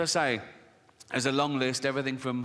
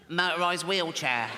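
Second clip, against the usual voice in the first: put-on comic child voice for the same line. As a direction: do little Timmy's voice again. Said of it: "I promised Jane I wouldn't do the voice".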